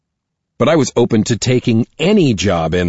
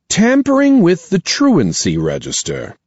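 stern principal voice; 11 semitones higher than usual. Acted. Principal reading out charges.

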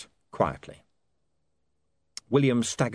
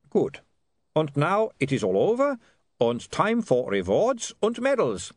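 cheerful; 8 semitones higher, 3 dB louder than usual. A narrator's reading. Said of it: "said the vampire cheerfully".

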